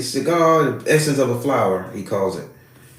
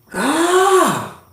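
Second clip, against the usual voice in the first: high, long "ah" dropping at the end; imitated spirit's "ah". Spirit reading a visitor, high imitated cry. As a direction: mimic a high cry of revelation. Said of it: "He said".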